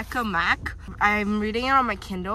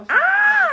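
excited squeal; 5 dB louder than usual. Real, not acted. Opening kebab.

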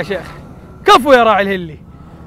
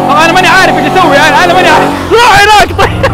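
rushed excited babble; 9 semitones higher, 5 dB louder than usual. Real, not acted. Laughing at his own confusion.

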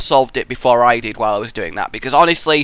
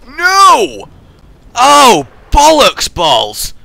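high, loud frustrated cry; angry yell; 11 semitones higher, 10 dB louder, much slower than usual. Real, not acted. Gamer cursing at the map after dying, high and loud. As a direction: bellow the curse out loud. Yelling at failure.

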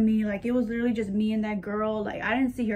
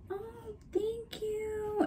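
a high, slow, awkward thank-you; nervous thanks; 10 semitones higher, 11 dB quieter, much slower than usual. Retold or imitated. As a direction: shrink away politely, up high and slow. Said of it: "laughed, like, kinda nervously".

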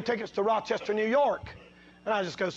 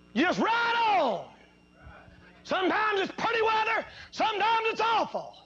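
preaching at full force; 10 semitones higher than usual. Acted. Preacher to his congregation, shouting it out high. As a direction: lift it to the rafters like a revival preacher.

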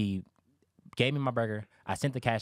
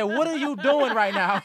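laughing; 11 semitones higher, 8 dB louder, slower than usual. Retold or imitated. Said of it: "He said".

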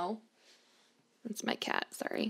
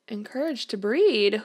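ordinary talk, nothing marked

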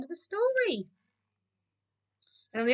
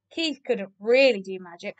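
usual speaking voice

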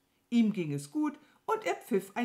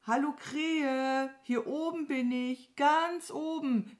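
hoher, gedehnter Ruf; fröhliches Rufen; 9 semitones higher, 3 dB louder than usual. A narrator's reading. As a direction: call out high and drawn-out from up above. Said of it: "rief Mama Mu". (langgezogen von oben rufen)

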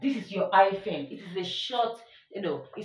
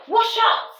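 much higher and louder shout of "Wash out!"; emotional exclamation; 14 semitones higher, 7 dB louder than usual. Shown as a demonstration. Teacher acts out an exclamation with a high shout. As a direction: fling it out in alarm. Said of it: "it arouses one's emotion".

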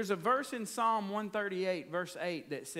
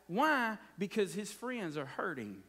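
normal talking voice

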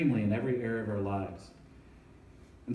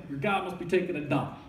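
higher, scoffing put-on jeer; mocking taunt; 4 semitones higher than usual. Preacher paraphrasing Elijah's taunt, higher scoffing jeer. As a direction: tease them like Elijah would. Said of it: "He's like".

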